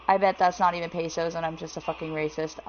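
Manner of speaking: normal talking voice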